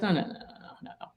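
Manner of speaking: breathy unvoiced no, no, no, barely there; retold whispered no. Editor waving off her answer, whispering. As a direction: brush it off in a soft whisper. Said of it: "And he goes".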